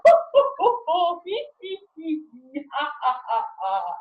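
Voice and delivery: a big belly of hoots, then tailing off; acted laughing. Laughing alone in the car in traffic, hooting high and fading.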